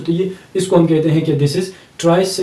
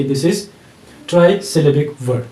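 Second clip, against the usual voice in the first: slow teaching emphasis; 2 semitones lower, much slower than usual. Shown as a demonstration. Teacher naming the term slowly for students. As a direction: stretch trisyllabic out slowly. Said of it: "تو آپ یہ سمجھ سکتے ہو".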